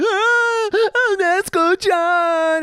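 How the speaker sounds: high, loud, drawn-out wail; hammed-up pleading scream; whiny Zenitsu. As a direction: whine and shriek like a needy anime crybaby. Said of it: "Zenitsu from Demon Slayer, the guy's just like".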